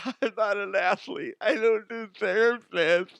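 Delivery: high, blubbering sob imitation; hammed-up tearful wail, dragging out the words; mock crying. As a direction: blubber it in mockery. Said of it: "gonna be crying in the locker room".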